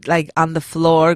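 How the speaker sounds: usual speaking voice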